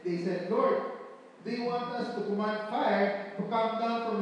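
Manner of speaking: loud, slow scripture reading from the pulpit; booming sermon reading; preaching scripture. Acted. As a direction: proclaim the verses with force. Reads scripture at full force.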